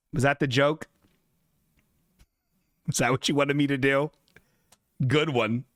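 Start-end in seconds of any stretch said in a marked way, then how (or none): none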